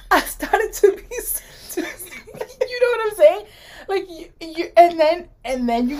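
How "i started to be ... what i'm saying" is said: laughing. Real, not acted. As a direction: squeeze it out while laughing. Cannot stop laughing.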